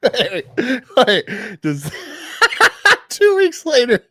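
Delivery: high, loud laughing disbelief; cracking up while trying to ask. Real, not acted. Cohost bursts out laughing mid-recap, laughing. Host cracks up over comic.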